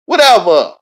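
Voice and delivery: loud exasperated "Whatever"; shouted dismissal. Acted. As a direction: bark "Whatever" out hard. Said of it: "Whatever, man".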